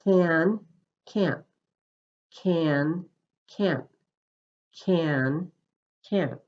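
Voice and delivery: "Can" with a held vowel, "can't" clipped short; "Can" long and voiced, "can't" cut off by the t; can versus can't. Shown as a demonstration. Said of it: "Let me say the pair for you".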